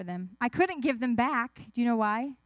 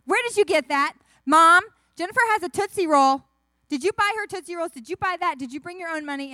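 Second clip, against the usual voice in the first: high, loud rapid-fire tattling; loud tattletale; 6 semitones higher, 10 dB louder than usual. Acted. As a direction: blare it out like a sister telling Mom. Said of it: "she can't say it quietly".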